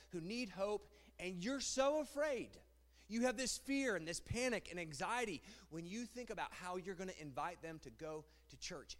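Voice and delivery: ordinary talk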